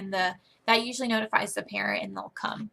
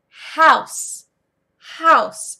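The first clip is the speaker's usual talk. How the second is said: loud, higher model word with a falling end; emphatic classroom word drill; teacher modeling; 5 semitones higher, 10 dB louder than usual. Shown as a demonstration. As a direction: punch it out clearly for the pupil. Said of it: "you'll say".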